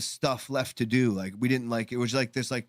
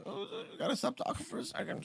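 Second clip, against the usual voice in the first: very quiet, high, squeezed voice; choked up; 11 dB quieter, faster than usual. Retold or imitated. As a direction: voice giving out as emotion rises. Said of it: "got me a little like".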